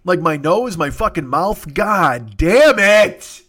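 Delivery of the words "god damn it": drawn-out, loud fed-up groan; exasperated groan. Real, not acted. Sick and dripping, cursing his own leaking head, long fed-up groan.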